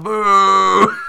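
high, loud booing yell; mock crowd boo. Irish crowd booing the winner on his way out, loud high boo.

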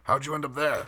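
high-pitched cartoon character voice; Strong Bad. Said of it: "Strong Bad walks up and goes".

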